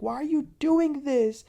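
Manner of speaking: high-pitched, pleading retold upset; mock hysterical pleading, pitched high; imitated hysterics. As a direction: imitate someone crying out in desperate protest. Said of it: "She was, like, very, very, very ... hysterical".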